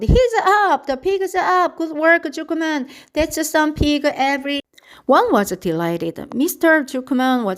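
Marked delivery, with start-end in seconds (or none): 0.0-4.6 high, excited shouts; crowd cheering. A narrator's reading. The crowd cheers as the pig gets up, high and excited.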